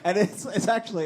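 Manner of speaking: giggling through the words; laughing and talking. Real, not acted. Laughing while retelling.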